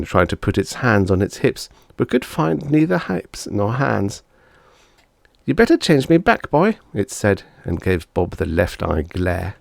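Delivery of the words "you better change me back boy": high, louder threatening chicken; a cross little girl warning. Acted. Bonnie warning Bob, high threatening voice.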